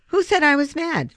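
high, loud defensive snap; defensive denial. A narrator's reading. Boy denying he is mad, high and defensive. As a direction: deny it sharply. Free denies being mad.

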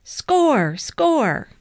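high, loud repeated urging; yell. A narrator's reading. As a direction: yell it from the sideline, each one falling. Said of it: "Coach Frensky yelled".

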